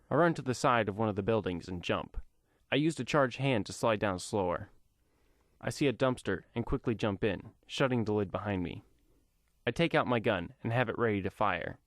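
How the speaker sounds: ordinary delivery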